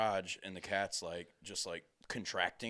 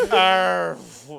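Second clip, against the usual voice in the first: loud, high animal cry; imitated cat yowl; 7 semitones higher, 18 dB louder than usual. Man imitating his cat giving birth, loud yowl. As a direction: belt out a cat in labour. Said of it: "the cat just starts going".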